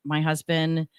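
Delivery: normal talking voice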